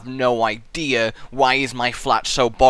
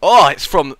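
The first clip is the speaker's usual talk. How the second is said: ordinary delivery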